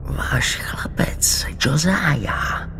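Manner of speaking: slow, raspy whisper; rasping voice. A narrator's reading. Old patient whispering to the nurse, raspy and sinister. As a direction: creep out a sharp, raspy whisper. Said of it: "řezavým, drsným hlasem".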